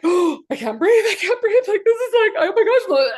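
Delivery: high, loud panicky cry; panicked. Retold or imitated. Retelling her first snorkel, she squeaks in loud panic. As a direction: voice shooting up in alarm as she can't breathe. Panicking underwater, can't breathe.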